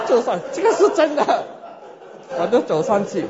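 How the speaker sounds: talking high and loud through laughter; laughing. Real, not acted. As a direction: laugh while insisting it's true. Laughs recalling walking up front.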